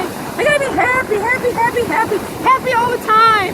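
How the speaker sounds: high, mocking chant of cheer; mocking forced happiness. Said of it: "What kind of shit is that?".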